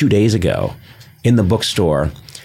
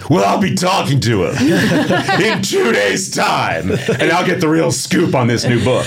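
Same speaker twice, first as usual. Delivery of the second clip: higher, odd put-on voice breaking into laughter; pretend smug voice; 8 semitones higher than usual. Host imagines telling the shoppers he'll interview the author, in a high, laughing put-on voice. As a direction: brag it with a laugh like a snooty insider. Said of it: "in that voice".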